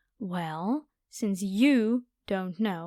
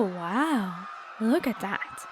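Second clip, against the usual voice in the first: ordinary delivery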